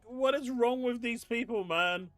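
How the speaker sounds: ordinary talk, nothing marked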